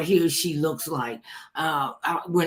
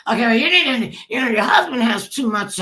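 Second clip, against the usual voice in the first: higher, louder imitation of meddlers; mock reproach; 7 semitones higher, 6 dB louder than usual. Producers telling her about her husband's hold, mock reproachful. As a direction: imitate someone bossily raising a complaint.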